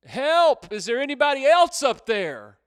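high, loud comic punchline cry; yelling for help. Acted. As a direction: call past God for someone else. Jack rejects God, yells for help.

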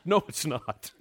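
chuckling, teasing no; laughing disbelief. Retold or imitated. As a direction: laugh off the caller's claim. Laughing disbelief at a phone caller.

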